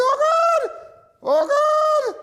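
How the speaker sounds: high falsetto wail of "Oh, God!"; mock cry. Retelling a plea, in a high mock wail. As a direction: squeal it up high in mock panic.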